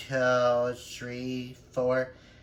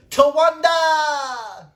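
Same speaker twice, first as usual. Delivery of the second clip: high, loud, drawn-out yell; triumphant movie catchphrase shout; rallying cry; 11 dB louder, much slower than usual. Acted. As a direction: holler it like a battle cry, last vowel held long.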